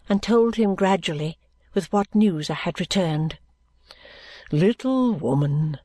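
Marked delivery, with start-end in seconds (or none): 4.5-5.8 gentle guardian voice. A narrator's reading.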